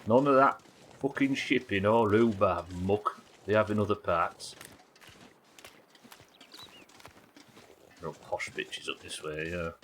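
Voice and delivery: put-on slow, broad South Yorkshire Rotherham accent; a gruff Rotherham local grumbling about posh folk, slow; thick Rotherham Yorkshire accent, slow. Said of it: "Right, Rotherham one".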